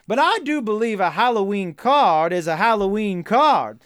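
hammed-up Southern country lawyer; bad Southern accent. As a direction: plead to the jury in a fake Southern accent. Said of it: "please stop doing a bad Southern accent".

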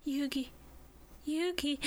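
soft, limp high calls; mock Sub Taya. Sub Taya calling Yugi, faint mock plea. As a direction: call out weakly, half-hearted. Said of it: "Sub Taya just goes".

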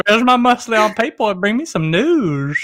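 put-on high, creepy old-man character voice; Herbert the pervert. Showing co-hosts the Family Guy voice, high pervy old man. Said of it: "talk like Herbert the pervert from Family Guy".

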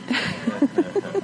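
a quick high-pitched laugh; high giggle. Real, not acted. Campus minister giggling before his doctor jokes, high laugh. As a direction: giggle at your own nerves.

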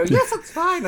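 put-on high, loud genteel old lady; prim old lady. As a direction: answer like a posh granny. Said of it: "they always go".

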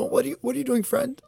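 high, gentle, calm imitation. Imitating the man talking calmly to a bear, very calm voice. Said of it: "like, a very calm, calm voice".

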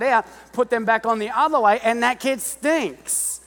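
loud, high, driving sermon delivery; revved-up preacher shouting to the crowd; preaching at full force. Real, not acted. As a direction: preach it hard to the room.

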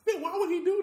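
high, laughing question; laughing disbelief. Real, not acted. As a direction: let the laugh push the voice up. Laughing at the scene.

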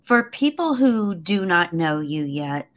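usual speaking voice

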